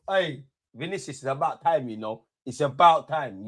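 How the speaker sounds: ordinary talk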